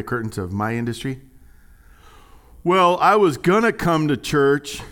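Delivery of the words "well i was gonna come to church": higher, louder, slow whiny excuse voice; mock excuse. Pastor mocking a churchgoer's flimsy excuse, whiny. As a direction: drag out the lame excuse, mocking.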